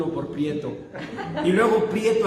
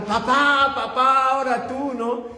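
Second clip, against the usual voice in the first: put-on kids calling out; 7 semitones higher, 3 dB louder than usual.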